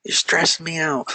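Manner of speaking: high, mock-fretful student voice; put-on high protest; imitated stressed student. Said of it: "you're probably like".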